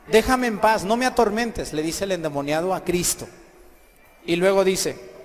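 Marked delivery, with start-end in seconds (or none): 0.1-1.7 raised, higher voice, insistent; acted protest. Possessed man begs Christ to stop, insistent protest. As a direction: push the tormentor away loudly.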